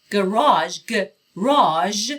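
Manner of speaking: "گاراژ" repeated with a long ah and a final zh, not j; American garage. Shown as a demonstration. Teacher drilling the American "گاراژ" for students, zh ending. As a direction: drill "گاراژ" slowly, guh-RAHZH, ending in zh. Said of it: "صدای ژ نه ج".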